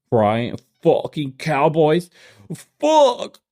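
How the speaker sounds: high, slow frustrated fan groan; mock fan curse. Mocking a sore fan cursing his team.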